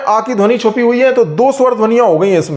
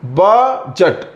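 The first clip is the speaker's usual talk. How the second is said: very slow बजट, first syllable ब stretched and stressed; 3 semitones lower, much slower than usual. Shown as a demonstration. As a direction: hold the ब in बजट, then a short जट. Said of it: "पहले वाले syllable पर stress है". (बजट में पहले syllable ब को खींचकर ज़ोर)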